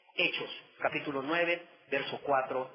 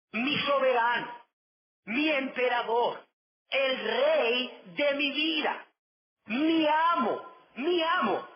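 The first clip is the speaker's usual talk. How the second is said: higher and louder, rising to a fervent cry; 9 semitones higher, 4 dB louder, slower than usual. Acted. Paul calls Jesus his master, a rising fervent cry. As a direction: build each title into a passionate cry. Preacher voicing Paul's devotion.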